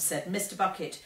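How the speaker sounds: usual speaking voice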